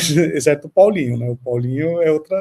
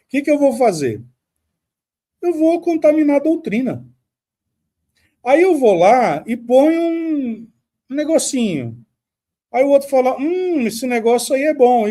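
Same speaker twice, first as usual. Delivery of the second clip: higher, scheming devil voice musing aloud; imitating Satan; 7 semitones higher than usual. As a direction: play a sly tempter plotting his move. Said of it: "É como se ele tivesse falado assim". (diabo ardiloso, voz mais aguda, tramando)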